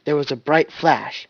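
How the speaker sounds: ordinary delivery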